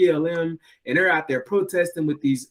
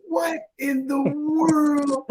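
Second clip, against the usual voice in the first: stunned disbelief; 11 semitones higher, much slower than usual. Retold or imitated. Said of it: "And I said".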